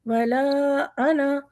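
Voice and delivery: अना said short, not elongated; short ana. Shown as a demonstration. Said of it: "वो वाला अना है जिसे हमें लंबा नहीं करना". (अना को लंबा न करें)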